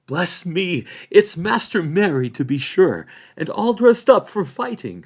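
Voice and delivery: put-on surprised and flustered old hobbit. A narrator's reading. Old Hob voiced.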